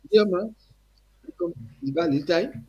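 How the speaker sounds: put-on high, hearty Guyanese accent. Eddie's father greets the young singer in a high Guyanese accent. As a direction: greet him like an old Guyanese man. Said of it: "Strong accent again".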